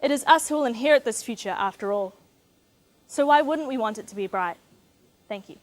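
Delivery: ordinary talk, nothing marked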